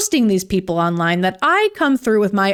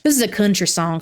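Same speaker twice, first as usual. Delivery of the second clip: normal talking voice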